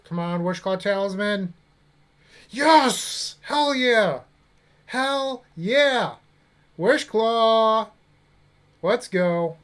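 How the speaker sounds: loud, high triumphant shouts; excited yelling. Real, not acted. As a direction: cheer the lucky draw at full volume. Topdeck hit, celebrating win.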